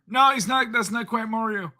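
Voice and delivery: very high cartoon falsetto plumber; Mario. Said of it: "Old [name] Mario voice?".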